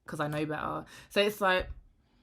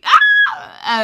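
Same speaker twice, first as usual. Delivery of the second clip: loud high shriek; 22 dB louder than usual. Real, not acted. Laughing it off to her viewers with a shriek. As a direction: let out a sudden squeal. Sudden outburst after joke.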